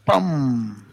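"pam" popped from closed lips; P explosiva. Shown as a demonstration. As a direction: press the lips and burst out "pam". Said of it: "Juntas los labios y haces un". ("pam" con los labios juntos y explosivo)

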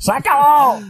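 high, loud mock shout. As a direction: cut him off with a shout. Cutting off the joke. (grito alto y teatral)

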